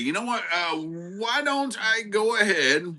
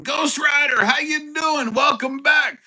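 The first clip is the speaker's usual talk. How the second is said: loud, high, cheery welcome; excited greeting; 9 semitones higher, 4 dB louder than usual. Real, not acted. Host greeting a returning viewer, loud and cheery. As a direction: call out the name with big enthusiasm.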